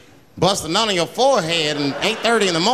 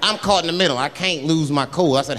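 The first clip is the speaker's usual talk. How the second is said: normal talking voice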